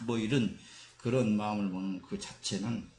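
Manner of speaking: ordinary delivery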